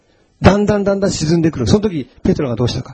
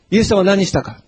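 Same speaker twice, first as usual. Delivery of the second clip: ordinary talk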